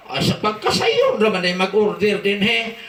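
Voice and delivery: usual speaking voice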